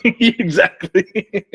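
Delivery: high, slow, laughing exactly; laughing agreement. Real, not acted. Agreeing with his buddy about Air Force women, laughing. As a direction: draw it out through a laugh.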